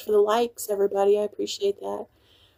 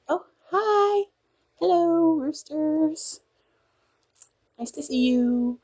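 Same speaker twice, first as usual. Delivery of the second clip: much higher, bubbly hello to a newcomer; excited greeting; 8 semitones higher, slower than usual. Retold or imitated. Cook greeting a soldier with a thick accent, excitedly high. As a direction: greet them with giddy delight. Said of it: "comes in with a really thick accent".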